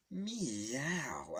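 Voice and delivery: long, swooping put-on meow; drawn-out kitty cry; cat meow. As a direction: mimic a cartoon cat. Imitating Mario as a cat.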